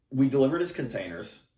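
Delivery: usual speaking voice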